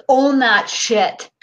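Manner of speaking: very slow, each word weighed; slow relayed command. Retold or imitated. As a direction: drag out "Own that shit" firmly. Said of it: "He says".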